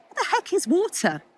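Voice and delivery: put-on high, puzzled young fish; squeaky high puzzled fish voice. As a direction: pitch it up like a baffled little fish. Young fish in told story.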